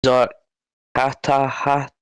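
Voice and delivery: very slow, halting attempt at a name; unsure sounding-out. Real, not acted. Tentative attempt at an unfamiliar name.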